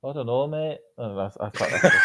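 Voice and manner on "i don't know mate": softer, higher, terrible Brummie attempt; bad Birmingham accent. Shown as a demonstration. Co-host attempting Birmingham on request, bad Brummie accent. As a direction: have a half-hearted go at Brummie. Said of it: "a terrible accent".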